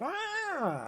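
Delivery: high whiny groan, imitating her; mock groan. Partner mimicking her cry when the cat walks on her, high whiny groan. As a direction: squeal it out like the sleepy wife. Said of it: "You're just like".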